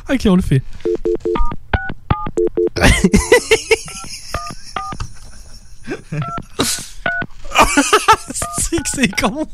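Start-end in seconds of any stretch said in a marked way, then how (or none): 0.7-5.2 high, helpless wheezing laughter; long laughing fit. Real, not acted. Friends cracking up over a silly prank call, helpless laughter. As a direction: lose it completely, laughing till it squeaks.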